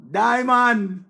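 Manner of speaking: loud, high, drawn-out holler; calling out. Real, not acted. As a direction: holler the name across the room. Calling out a name on live.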